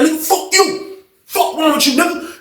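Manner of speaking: high-pitched mock cussing out; retold cursing match.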